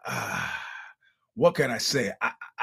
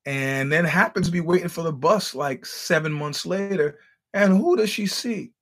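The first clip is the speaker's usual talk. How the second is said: normal talking voice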